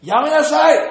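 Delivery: high, loud shouted command; forceful acted reprimand; angel's sharp rebuke. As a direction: shout to stop someone at once. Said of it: "天使はね".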